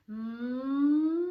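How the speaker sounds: a long rising hum; sung hum. Shown as a demonstration. Voice coach demonstrating a warm-up hum. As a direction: hum a smooth rising note. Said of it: "doing a simple exercise like".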